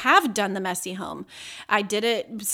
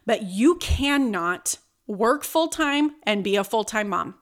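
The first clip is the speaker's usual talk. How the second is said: ordinary delivery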